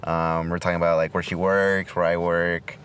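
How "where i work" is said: normal talking voice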